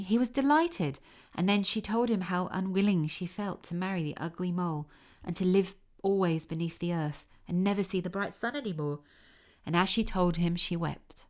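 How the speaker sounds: ordinary delivery, nothing marked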